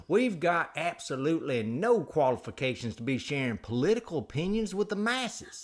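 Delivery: swooping theatrical host, rising high; exaggerated folksy announcer; hammed-up show intro. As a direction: play up the grand disclaimer. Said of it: "do the whole show like that".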